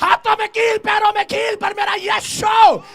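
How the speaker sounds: high, loud preaching shout; fiery sermon shout; full-force preaching. Acted. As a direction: preach it out to the crowd.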